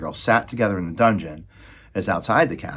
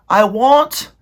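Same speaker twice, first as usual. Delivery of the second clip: put-on high, loud servant-girl voice; Meg's voice; 13 semitones higher, 12 dB louder than usual. Maid Meg answering Roscuro, high and loud. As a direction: squeak it out as the simple maid.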